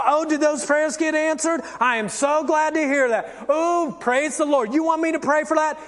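put-on gushing, high-pitched chat with a girlfriend; sweet phone voice. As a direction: switch to syrupy churchy friendliness on the phone. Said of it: "you pick up the phone".

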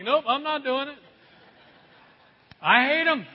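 a hammed-up no from the pews; stubborn refuser. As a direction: play the grumpy churchgoer who won't budge. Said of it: "some of you are like".

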